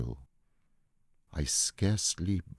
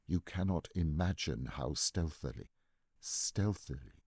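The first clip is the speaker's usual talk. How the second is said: hushed, conspiratorial telling; 8 dB quieter than usual. A narrator's reading. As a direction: draw the listener in, hushed. Narrator describes creeping into the room.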